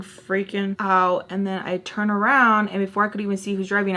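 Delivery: ordinary talk, nothing marked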